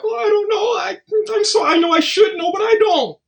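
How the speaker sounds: hammed-up sob story voice, pitched high; mock whiny crying. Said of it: "You laughing like".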